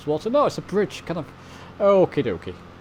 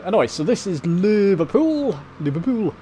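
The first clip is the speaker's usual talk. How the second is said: a playful, lightly put-on Liverpool Scouse accent, higher and louder; bad Scouse accent; 6 semitones higher, 5 dB louder than usual. Sim pilot to viewers, arriving over Liverpool, in a hammed-up Scouse accent. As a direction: have a go at a Scouse accent and don't quite land it. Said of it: "Terrible accent there".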